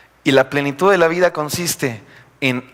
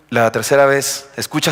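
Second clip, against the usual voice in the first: ordinary delivery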